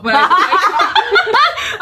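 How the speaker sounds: laughing. Real, not acted. Laughing while retelling.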